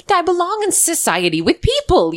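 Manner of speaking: put-on high prim English accent; a hint of a posh Minnie Driver; modern British accent. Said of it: "I can't do a good Minnie Driver impression".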